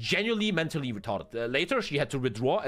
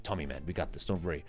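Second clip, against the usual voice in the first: put-on much softer and lower, calming a scared kid; reassuring teacher; 10 semitones lower, 6 dB quieter than usual. Kind teacher calms frightened boy before the police, soft reassurance. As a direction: gently soothe him, it will be fine. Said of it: "And she was like".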